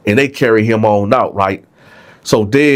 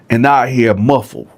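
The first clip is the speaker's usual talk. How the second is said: normal talking voice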